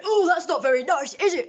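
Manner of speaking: high, loud mock telling-off; scolding guard. Security guard confronting a customer, loudly scolding in imitation. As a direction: mimic an indignant guard, pitched right up.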